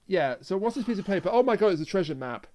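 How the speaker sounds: ordinary delivery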